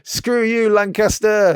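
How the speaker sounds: high, loud taunting yell; jeering shout. Pretend. Fan taunting Lancaster's supporters, in a high jeering yell. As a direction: jeer at the rival side with full lungs. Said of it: "I'm shouting".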